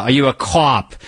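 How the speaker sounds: Boston "cop", vowel rounded too hard; overdone Boston accent. Shown as a demonstration. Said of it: "when they hit that too hard".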